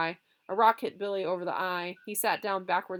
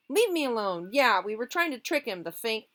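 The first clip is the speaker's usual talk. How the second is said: protesting; 5 semitones higher, 6 dB louder than usual. Acted. Boy protesting to father.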